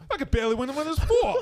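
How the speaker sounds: put-on laughing, thick New York accent; mock New York accent. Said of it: "thick on your New York accent".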